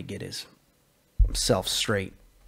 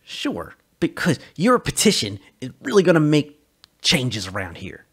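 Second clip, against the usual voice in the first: snapped sarcasm; 3 semitones higher, 7 dB louder than usual. Acted. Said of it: "Sully snapped back".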